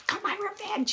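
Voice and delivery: high, soft, sly gloat; sly gloating old man. Retold or imitated. Medium imitating the old man's cheeky gloat, high and soft. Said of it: "He's saying it like that".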